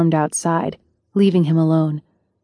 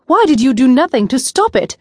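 higher and louder, angry demand; 6 semitones higher, 5 dB louder than usual. A narrator's reading. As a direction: push him hard, voice rising in anger. Ari confronts the prince angrily.

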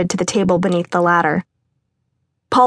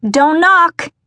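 high, loud call from inside the house; yelled; 10 semitones higher, 5 dB louder than usual. A narrator's reading. Someone inside calling to the visitor, loud high yell. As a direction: shout it through the door. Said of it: "someone yelled".

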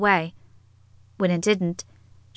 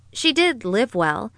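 ordinary delivery

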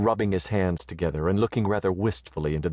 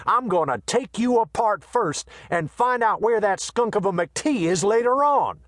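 a swaggering Western tough, raised high; brash gunman voice; 8 semitones higher than usual. A narrator's reading. Quay boasts, then insults Harrigan.

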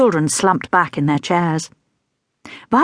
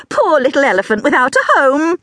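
higher, louder fond lament; mock pity; 9 semitones higher, 4 dB louder than usual. A narrator's reading. Teacher playfully pitying the rubber elephant, higher.

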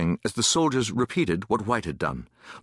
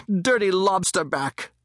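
high, drawn-out taunting shout; shouted insult; 9 semitones higher, slower than usual. A narrator's reading. Boy grabbing a soldier's uniform, shouting abuse. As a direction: yell the insult slowly. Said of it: "began to shout".

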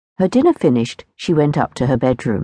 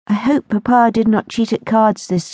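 put-on lightly raised young lady's voice; a worried young girl, voiced lightly higher; girlish Lucinda voice; 7 semitones higher than usual. A narrator's reading. Lucinda frets to her dream friend, in a lightly higher girlish voice. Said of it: "said Lucinda to her dream friend".

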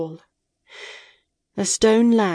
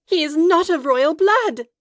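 much higher, loud insistence; an anguished outcry to spare him; desperate protest; 11 semitones higher, 4 dB louder than usual. A narrator's reading. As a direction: cry out in his defense. Mara protesting to the shadow.

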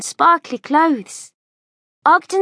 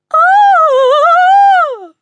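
high shrieking scream, very loud; piercing terrified scream; lady's scream; 8 dB louder than usual. A narrator's reading. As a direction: shriek in sudden terror. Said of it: "screamed the lady".

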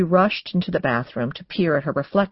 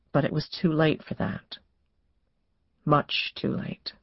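soft narration slowing to a near-whisper; hushed, grave; 6 dB quieter than usual. A narrator's reading. Narrator as the dogs fail to protect her, hushed. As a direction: let it sink away, softly. Narrator closes the shooting scene.

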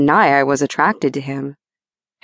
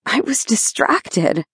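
wheezed; 5 semitones higher, slower than usual. A narrator's reading. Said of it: "I wheezed out".

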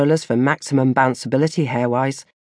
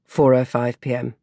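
plain reading voice

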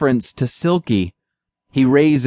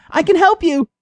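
mouse calling out. A narrator's reading. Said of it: "Jenna yells out".

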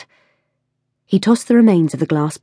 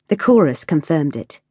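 ordinary delivery, nothing marked

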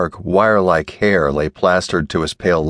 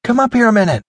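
high call down the stairs; insistent raised call; much faster than usual. A narrator's reading. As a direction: call him up, pitched high and carrying. Anne calls Joel up again.

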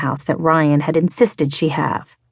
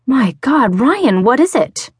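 higher, a bit louder frightened question; shaken, worried cry; alarmed; 8 semitones higher, 3 dB louder than usual. A narrator's reading. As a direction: voice lifted in fear at the sight of him. Finds him retching, frightened.

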